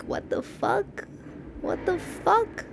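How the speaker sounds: high, slow stunned whisper; shocked disbelief. Shown as a demonstration. Showing horrified laugh reaction, high shocked disbelief. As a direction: gasp it out in disbelief. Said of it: "but laughing like".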